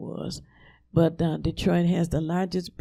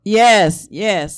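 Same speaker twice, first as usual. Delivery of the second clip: loud yes to a faint caller; to someone hard of hearing; 2 semitones higher, 13 dB louder than usual. Real, not acted. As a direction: call back loudly.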